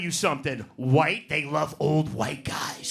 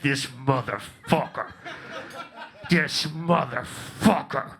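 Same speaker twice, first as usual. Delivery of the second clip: slow mock muttered curse; 6 semitones lower, much slower than usual. Filipino lady glaring, slow.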